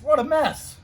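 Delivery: high, loud cry of dismay; dismayed exclamation. A narrator's reading. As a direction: throw up your hands at the mess. Paint smears everywhere.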